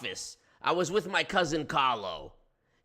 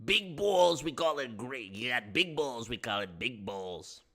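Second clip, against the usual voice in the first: lightly voiced De Niro tough guy; a hint of mobster swagger; New York accent.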